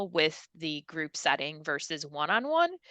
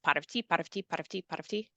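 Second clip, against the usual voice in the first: "pot of tea" rattled off quickly, growing softer; said really fast; 7 dB quieter, faster than usual. Shown as a demonstration. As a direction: fire off "pot of tea" over and over. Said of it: "Say it really fast".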